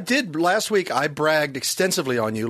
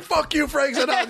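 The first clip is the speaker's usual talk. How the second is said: mock cursing back; 10 semitones higher than usual. Son cursing back at father in bit, high mock outburst. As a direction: imitate an indignant son's outburst. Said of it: "he would curse me out".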